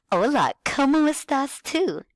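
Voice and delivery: high, bright singsong teacher voice; kindergarten teacher voice. Shown as a demonstration. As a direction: talk like you're greeting small kids. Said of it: "talk to them like you're a kindergarten teacher".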